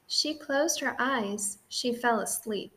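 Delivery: usual speaking voice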